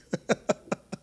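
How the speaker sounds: brief breathy laugh; short chuckle. Real, not acted. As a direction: chuckle at your own aside. Chuckles after his dialect aside.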